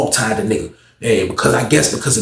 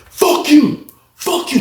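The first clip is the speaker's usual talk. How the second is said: high-pitched mock cursing; acted angry defiance; 14 semitones higher, much slower than usual. Inmate refusing to get down, high angry defiance. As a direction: throw it back at her, voice way up.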